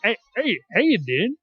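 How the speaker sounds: Joey impression. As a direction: slide up on "Hey, hey, how you doing?" like a flirty New Yorker. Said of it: "the, the New York?".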